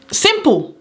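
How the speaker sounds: Simple jumps high and lands with a firm fall; emphatic. Real, not acted. Telling new care workers that asking is easy, emphatic Simple. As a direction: punch Simple as if it is obvious.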